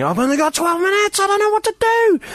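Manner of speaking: high-pitched panicked wail; mock panic. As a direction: squeal it out in a comic fluster. Comic recalls panicking on train.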